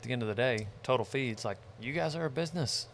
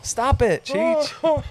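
high laughing yell; 13 semitones higher, 8 dB louder, much slower than usual. Real, not acted. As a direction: shout it, high and cracking. Yelling at Cheech, laughing.

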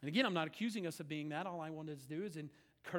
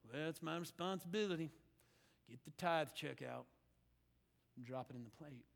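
lightly acted reluctant giver, low and soft; dutiful resignation; 4 semitones lower, 6 dB quieter than usual. Churchgoer tithing out of mere duty, mildly resigned. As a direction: sink lower and softer, as if it's a chore.

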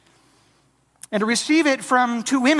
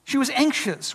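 ordinary delivery

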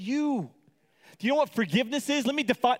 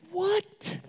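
high, rising, acted disbelief; incredulous; 12 semitones higher than usual. Lender hearing his debtor offer dessert, incredulous. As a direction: squeal it as a stunned question.

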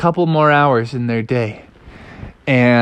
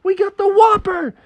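high, loud victory shout, slowing at the end; triumphant dad; 3 dB louder, slower than usual. Acted. Dad to Froggy landing the fish, high triumphant shout. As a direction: crow over the whopper. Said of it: "And Froggy's dad was like".

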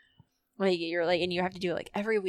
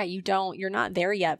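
normal talking voice

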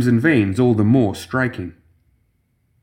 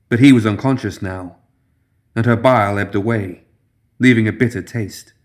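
ordinary delivery, nothing marked

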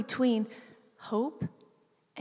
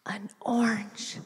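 put-on toddler voice. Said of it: "She goes".

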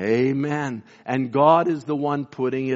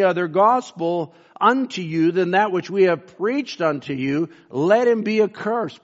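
scripture reading; 4 semitones higher than usual. Acted. Preacher reads Paul's letter aloud.

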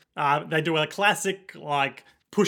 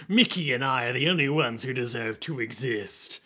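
low, menacing villain voice; a low, cold threat from an unhinged villain; evil voice; 5 semitones lower than usual. Retold or imitated. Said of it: "It's my evil voice".

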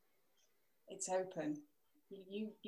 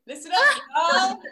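put-on American accent; 12 semitones higher, 16 dB louder than usual. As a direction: belt it out as a playful mock American. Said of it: "a nice American accent would be great".

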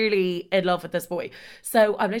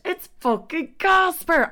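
high, loud, slow groan of disbelief; fed up that he's back; exasperated outburst; 10 semitones higher, 6 dB louder, slower than usual. Real, not acted. Exasperated at Casper again.

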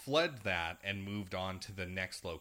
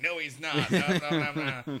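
laughing denial; 7 semitones higher, 9 dB louder than usual. Retold or imitated. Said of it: "your uncle's like".

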